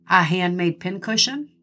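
ordinary talk

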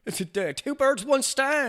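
high, smug father; mock dad voice. The father sums up the murders, high and smug. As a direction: deliver the idiom smugly.

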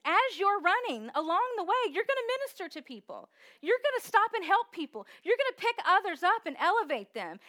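loud, high, driving sermon voice; full-force preacher, building; preaching. Acted. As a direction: preach it out to the congregation.

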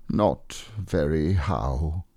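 slow, lightly voiced mournful donkey; gloomy Eeyore. A narrator's reading. Glum donkey answers his friend's greeting, slow and gloomy. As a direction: draw it out with a touch of gloom.

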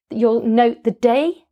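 usual speaking voice

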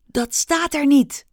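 high, desperate protest; desperate cry. Acted. Rikki cries out desperately to the stage. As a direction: cry it out in despair. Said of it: "riep Rikki wanhopig".

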